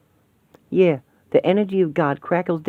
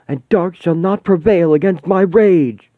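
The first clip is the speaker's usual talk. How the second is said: raging vow; 5 semitones higher, 8 dB louder than usual. Acted.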